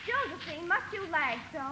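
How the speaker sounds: far-off, faint impatient call; distant calling. Acted. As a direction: call a lagging sister from up the road. Sister calls from up the road.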